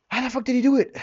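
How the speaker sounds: incredulous. Real, not acted. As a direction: cry out in amazed disbelief. Awed disbelief at father's achievement.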